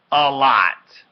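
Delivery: high, very loud retort; angry yell. A narrator's reading. Boy with sore nose answering sister, angry yell. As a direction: yell it back in pain. Hurt brother yells at sister.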